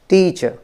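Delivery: Teacher with its first syllable high and prominent; teacher, strong first beat and light ending; stress on first syllable. Shown as a demonstration. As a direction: lean hard on the start of teacher. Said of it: "इसको मैंने prominent किया है".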